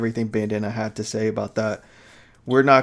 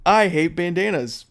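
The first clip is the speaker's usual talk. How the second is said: ventriloquist dummy voice; 7 semitones higher, 5 dB louder than usual. Pretend. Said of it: "I'm the ventriloquist here".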